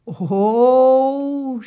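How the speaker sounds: rouxinol drawn out very slowly, long ROU; syllable by syllable. Shown as a demonstration. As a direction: stretch rouxinol into rou... xi... nol. Writing mnemonic word out slowly.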